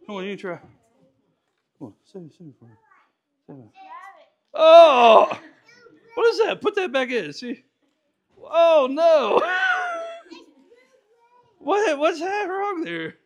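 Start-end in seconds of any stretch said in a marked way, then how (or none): 4.5-13.2 high, laughing horror; mock horror. Real, not acted. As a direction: react like it's a disaster. Watching the bill fall apart.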